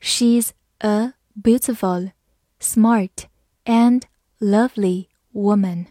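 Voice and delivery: "She's a beautiful, smart and lovely woman" slowly, word by word; slow reading. Shown as a demonstration. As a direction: pause between the words. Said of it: "我们慢慢来读".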